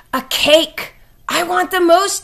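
high, loud insistent cat; demanding. A narrator's reading. Cat ordering cake from Dog, high and demanding. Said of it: "demanded Cat".

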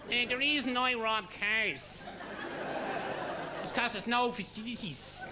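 high, rough Dublin guest voice, as mock as can be; a tough Dublin lad's voice, played over the top; mock caller from the show. Mimicking a Chris Barry Show guest.

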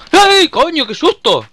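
high startled yell, very loud; frightened scream. Real, not acted. Jump scare in game.

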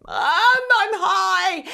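high-pitched, very slow, drawn-out wail; whiny high confession. Retold or imitated. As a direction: stretch it out like a panicked stoned kid. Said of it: "And I said".

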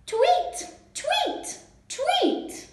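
very high, short tweets; high-pitched storybook birdsong; bird tweeting. A narrator's reading. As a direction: chirp like a little bird. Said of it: "the bird says".

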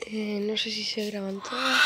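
ordinary delivery

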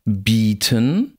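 "Bieten" with a long close ee; long tense vowel. Shown as a demonstration. Lecturer reads minimal pairs, long tense vowel.

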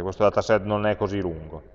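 ordinary talk

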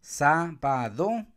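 "Sábado" slowly as sá, ba, do; split into syllables. Shown as a demonstration. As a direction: count out Sábado piece by piece. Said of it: "empiezo a contar".

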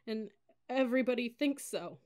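halting, hurt crying; sniffling. A narrator's reading. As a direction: choke it out with shaky breaths between phrases. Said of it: "I sniffed".

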